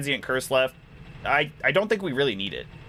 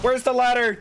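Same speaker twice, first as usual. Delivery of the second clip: higher and louder, alarmed; 12 semitones higher, 4 dB louder than usual. Real, not acted. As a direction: ask it in sudden panic. Searching for ladder, growing alarm.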